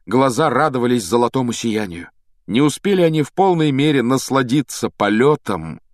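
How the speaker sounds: ordinary delivery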